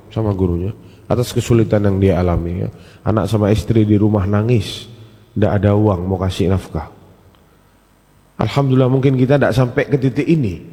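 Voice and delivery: ordinary talk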